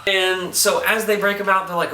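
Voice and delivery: ordinary talk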